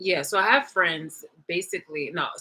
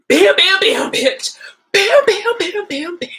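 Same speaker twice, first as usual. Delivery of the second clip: high, loud rapid-fire bams; imitated gunshots; 12 semitones higher, 12 dB louder, slower than usual. Acting out shots across the room, loud imitated gunfire. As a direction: fire off shrill-pitched gunshot noises.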